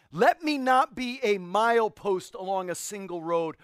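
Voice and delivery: loud, slow, impassioned prayer; fervent prayer. Retold or imitated. Preacher voicing a martyr's prayer, loud and slow. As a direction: cry it out as a pleading prayer. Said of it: "He said".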